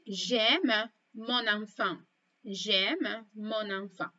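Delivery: slow, careful j'aime mon enfant; teacher demo, very slow; slow model phrase. Shown as a demonstration. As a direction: say it slowly for learners to copy. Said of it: "y también puedo decir".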